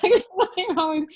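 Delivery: can't hold back a laugh mid-quote; giggling out the line. Real, not acted. Laughing while quoting her mom.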